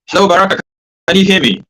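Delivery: loud, high shout down the line; shouting to someone hard of hearing. Real, not acted. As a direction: call out as if across a room. Calling to a guest on a bad line.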